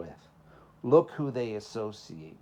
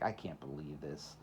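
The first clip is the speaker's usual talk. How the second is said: muttered low and much softer, glum; moping kid; 8 semitones lower, 10 dB quieter than usual. Retold or imitated. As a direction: sulk it under your breath like a fed-up kid. Kid's dismay quoted, much quieter.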